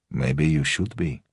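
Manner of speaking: put-on a light Russian accent, an understated threat; lightly voiced old Russian countryman; Russian-English accent. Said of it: "the accent forever caught somewhere between rural England and his Russian homeland".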